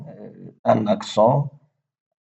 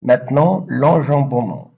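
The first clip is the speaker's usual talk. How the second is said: announcing heading; 3 semitones higher, 5 dB louder than usual. Shown as a demonstration. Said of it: "announcing a new topic".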